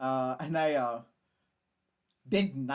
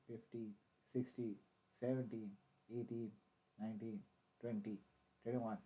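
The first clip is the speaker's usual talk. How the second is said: low, soft counting to himself, trailing softer; counting under breath; 6 semitones lower, 9 dB quieter than usual. Real, not acted. Streamer timing his stream delay, counting softly under his breath. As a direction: mutter the count quietly, checking the delay.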